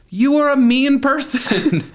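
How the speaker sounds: high, loud, slow scolding; mock scolding. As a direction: scold her for being mean. Refusing her request.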